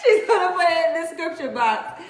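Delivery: high, loud, laughing through words. Real, not acted. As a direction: giggle while teasing her. Laughing at her friend.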